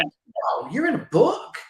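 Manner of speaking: breathy wow, then a high amazed question; mock amazement. Family member amazed at a book, in a high mock-astonished voice. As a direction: imitate someone astonished, rising high.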